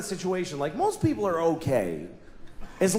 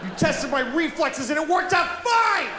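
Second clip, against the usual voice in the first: mock rant; 9 semitones higher than usual. Ranting at imagined driver.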